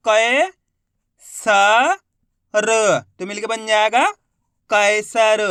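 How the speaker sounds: "क", "स", "र" held separately, then "कैसर" punched; letter-by-letter sounding out. Shown as a demonstration. Teacher showing learners how letters blend, syllable by syllable. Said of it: "एक अक्षर का उच्चारण सही से".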